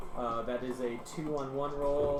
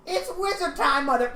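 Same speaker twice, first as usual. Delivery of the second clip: high, loud cocky hero voice; hammed-up wizard war cry; battle cry; 13 semitones higher, 7 dB louder than usual.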